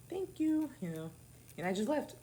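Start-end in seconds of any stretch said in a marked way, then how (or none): 0.1-0.7 high sweet thank you. Retold or imitated. To the cashier, cheeky high-voiced thank you. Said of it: "my high voice".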